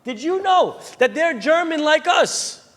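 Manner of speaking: high, drawn-out astonished reveal; acted astonished excitement; excited mother. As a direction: stretch out the amazing news. Mother excitedly reveals news to son.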